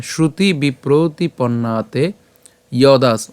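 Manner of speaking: slow, measured Sanskrit verse recitation, vowels drawn out; pure Sanskrit pronunciation. Shown as a demonstration. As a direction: recite the shloka slowly, syllable by syllable. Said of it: "উচ্চারণটা কেমন হচ্ছে?". (ধীরে, শুদ্ধ সংস্কৃত উচ্চারণে শ্লোক পাঠ)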